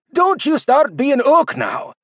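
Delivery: high, slow exasperated dwarf complaint; grumbling dwarf leader. Acted. Don complaining at the old man, high and slow. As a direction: scold him like a fed-up boss.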